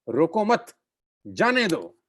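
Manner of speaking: "रोको मत" lifted on मत, pause, "जाने दो"; group रोको with मत, break, then release जाने दो; pause after मत. Shown as a demonstration. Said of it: "line तो बड़ी सिंपल है".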